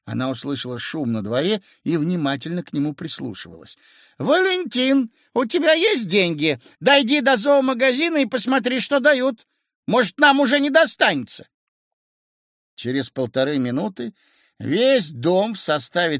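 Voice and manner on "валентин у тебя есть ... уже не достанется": high-pitched wife calling down to her husband; high, insistent shouted errand. A narrator's reading. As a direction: call down urgently from upstairs. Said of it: "крикнула Кацу жена со второго этажа".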